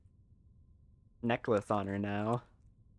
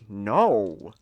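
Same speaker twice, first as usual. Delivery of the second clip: offended no; 8 dB louder than usual. Retold or imitated. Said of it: "super offended".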